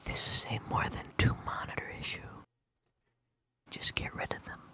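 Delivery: unvoiced whispered aside; whispering. Real, not acted. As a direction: whisper it under your breath. Aside about the monitors.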